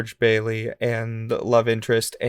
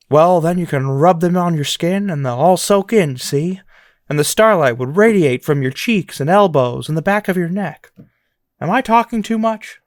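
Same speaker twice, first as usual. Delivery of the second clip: louder, a touch of Sean Connery; dreamy leading man, spinning a romantic fantasy; George Bailey impression; 4 semitones higher, 5 dB louder than usual. Host voicing George Bailey to Mary, with a hint of Connery. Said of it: "It's almost a little bit Sean Connery".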